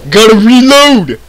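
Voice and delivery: high, drawn-out game-character call; Coach. Retold or imitated. Player catches himself sounding like a game character, high drawn-out yell. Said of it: "Why am I sounding like Coach?".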